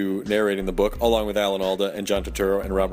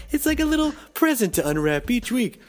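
high sing-song cutesy tone; 13 semitones higher than usual. Shown as a demonstration. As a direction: coo it high like a gift. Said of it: "did I really just refer".

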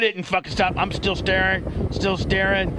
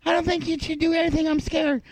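much higher, small frightened voice; high timid kid voice; 12 semitones higher than usual. Pretend. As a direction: answer like a nervous little kid. Voicing Ace answering him.

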